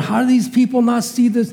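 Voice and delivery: incredulous rant at the screen; exasperated. Retold or imitated. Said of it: "I'm sitting there going".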